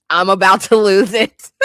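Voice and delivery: usual speaking voice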